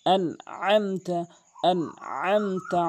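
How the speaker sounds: আনআমতা slow, আইন from mid-throat; correct Anamta. Shown as a demonstration. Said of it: "হলকের মাঝখান থেকে". (শুদ্ধ, আইন দিয়ে ধীরে)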